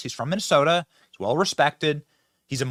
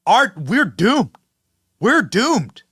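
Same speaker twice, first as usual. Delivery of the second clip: mock panic, higher and louder, slow; Dean Phillips panic; 6 semitones higher, 6 dB louder, much slower than usual. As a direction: wail it like a hammed-up doom cry. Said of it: "slamming the panic button, saying".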